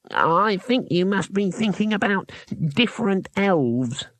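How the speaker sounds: slow, high gnome voice; slowly. A narrator's reading. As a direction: draw it out as a careful correction. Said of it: "the gnome said slowly".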